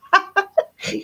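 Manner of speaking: high-pitched amused laugh; short high burst of laughter; real laugh. Real, not acted. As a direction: laugh at your own joke. Laughs at her own helium joke.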